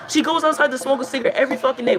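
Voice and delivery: normal talking voice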